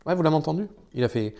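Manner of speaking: ordinary talk, nothing marked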